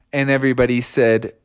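ordinary delivery

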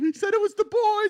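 very high, loud wail; mock whining kid. Kid blaming dad to mom, mock whining. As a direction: blame dad in a squealing child voice.

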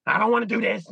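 a grown man mock-whining like a baby; whiny little baby. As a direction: whine it like a toddler refusing. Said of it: "all like a little baby".

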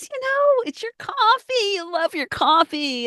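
playful high cooing about loving coffee; sing-song teasing. Acted. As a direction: coo it like teasing a coffee lover.

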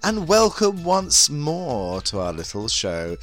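sultry campy hostess voice; breathy vintage purr. Acted. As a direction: purr it like a vintage glamour host. Bunny Galore hostess persona.

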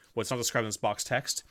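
normal talking voice

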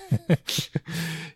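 a short high burst of real laughter. Real, not acted. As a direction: laugh out loud, amused. Laughing at the killer giving up.

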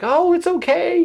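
very high, slow cooing comfort; mock soothing. Megan soothing a crying fan, high slow coo. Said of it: "Megan was like".

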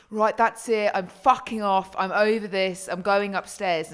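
loud, heated storming-off rant; angry shouting. Retold or imitated. Retelling partner storming off mid-argument, loud angry shouting. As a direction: shout it like you're done arguing. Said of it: "was busy shouting at me".

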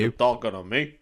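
lightly voiced tough-guy impression; a hint of a movie tough guy, quietly challenging; De Niro. Shown as a demonstration. Co-host demonstrating for his friend to copy, lightly voiced tough guy. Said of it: "You do a De Niro and I'll try and copy you".

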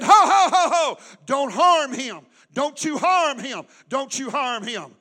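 loud laughing-then-firm angel cry; hammed-up angel shouting don't harm him; booming mock angel voice; angel calling out. Angel voiced stopping Abraham.

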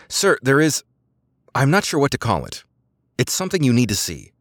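unsure how to report it; nervous subordinate. A narrator's reading. Officer nervously reports to commander.

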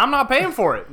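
high, loud indignant refusal; outraged homeowner. Acted. Homeowner to the installer who never got paid, outraged yell. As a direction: yell it back stubbornly.

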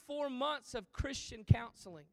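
ordinary delivery

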